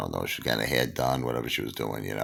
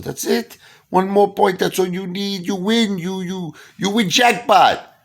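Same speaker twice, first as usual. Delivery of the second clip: hyped casino hustler; 4 dB louder than usual. Retold or imitated. Pablo hyping the jackpot.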